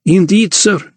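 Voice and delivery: high-pitched reply called after someone leaving; high, lightly projected character voice; calling after him. A narrator's reading. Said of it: "the Count called after him".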